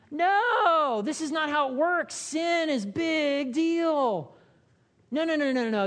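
high, loud inner protest; a pastor's frustrated inner scream. Retold or imitated. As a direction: let the silent scream out. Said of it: "on the inside, I'm just screaming".

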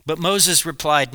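usual speaking voice

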